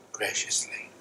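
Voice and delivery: a soft unvoiced whisper. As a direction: whisper the word graciously. Whispered word in sermon.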